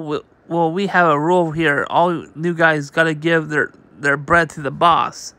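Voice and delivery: ordinary delivery, nothing marked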